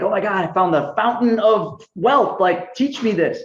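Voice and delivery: high, breathless thrill, slowing on teach me; excited. Retold or imitated. Calling Brent, thrilled about the fountain of wealth. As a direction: gush like you struck gold. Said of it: "and I'm so excited".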